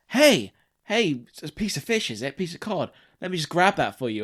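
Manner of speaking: sing-song customer service patter; chirpy shop server. Retold or imitated. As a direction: play the overeager chip shop worker again. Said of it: "they're like".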